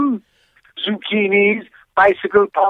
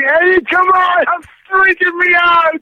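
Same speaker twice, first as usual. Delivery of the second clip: freaked out; 8 semitones higher than usual. Real, not acted. Startled by prank.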